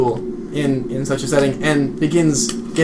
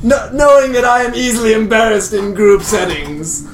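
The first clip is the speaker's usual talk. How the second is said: hammed-up, raised-voice incredulity; hearty bluster; 6 semitones higher than usual. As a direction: brush off the accusation as a joke. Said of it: "lets out a hearty laugh and says".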